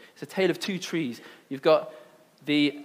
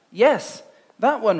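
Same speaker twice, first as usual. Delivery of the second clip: Eve voice; 5 semitones higher, 5 dB louder, slower than usual. A narrator's reading. Eve answers the snake.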